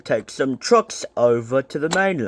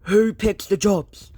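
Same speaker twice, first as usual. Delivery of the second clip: angry; 6 semitones higher than usual. A narrator's reading.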